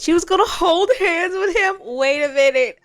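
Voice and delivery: high, loud squealing; giddy and laughing; excited squeal. Real, not acted. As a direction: squeal with delight at the couple. Squealing at the Sims couple.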